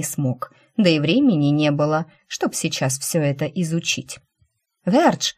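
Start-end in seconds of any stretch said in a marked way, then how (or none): 4.8-5.3 sharp high call of a name. A narrator's reading. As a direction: call his name across the room. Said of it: "окликнула его Рина".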